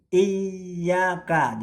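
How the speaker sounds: iyyaka with the yy held and stressed; held doubled y. Shown as a demonstration. Teacher shows students the correct iyyaka, yy held. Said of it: "bukan iyyaka tapi iyyaka".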